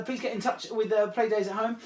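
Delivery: normal talking voice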